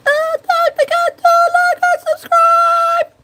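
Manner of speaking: very high, slow, strained squeal; sing-song sign-off pushed way up high; put-on high voice. Shown as a demonstration. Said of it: "of course I can".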